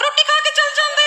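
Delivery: high, far-carrying mum's call; mum calling after them. Retold or imitated. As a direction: shout up the motorway after the guests. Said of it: "your mum's still like".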